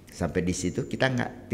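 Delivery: ordinary talk, nothing marked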